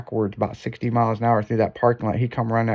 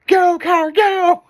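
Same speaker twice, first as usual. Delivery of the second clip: very high, loud cartoon cheer; exaggerated high-pitched rooting; mock cheering; 6 dB louder, much slower than usual. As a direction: cheer the car on in a squeaky shout. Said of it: "he was cheering me on".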